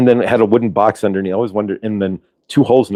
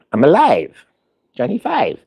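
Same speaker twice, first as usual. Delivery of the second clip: higher-pitched movie robot, lightly voiced; a hint of robot catchphrase voice; Johnny Five; 5 semitones higher than usual. Playing the robot from Short Circuit, light mimicry.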